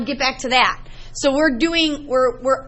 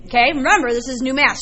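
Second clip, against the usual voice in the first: ordinary talk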